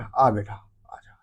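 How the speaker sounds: put-on soft, low coaxing to a child; mock loving father call; lovingly. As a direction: lure the kid in sweetly. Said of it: "पापा ने प्यार से बोला".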